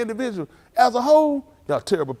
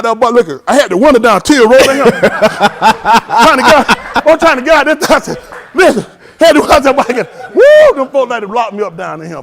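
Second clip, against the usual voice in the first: much louder, laughing through the words; 4 semitones higher, 13 dB louder than usual. Real, not acted. Telling friends the police chase, loud laughing. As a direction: crack up as you tell the getaway. Laughing while retelling the escape.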